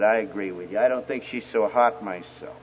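low, understated teasing deadpan; unimpressed dad. Retold or imitated. Dad teasing his son about the girlfriend, deadpan. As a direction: puncture the gushing with a soft, low aside. Dad deflating son's enthusiasm.

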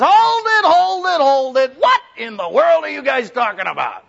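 loud high cry to stop; shouting. Retold or imitated. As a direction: yell it to cut them off. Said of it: "And finally he says".

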